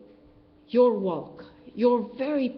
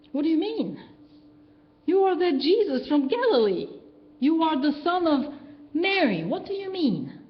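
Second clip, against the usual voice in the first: incredulous challenge; 6 semitones higher than usual. Retold or imitated. Skeptic challenging Jesus.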